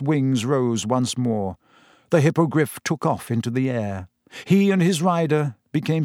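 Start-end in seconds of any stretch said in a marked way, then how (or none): none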